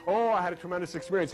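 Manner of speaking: normal talking voice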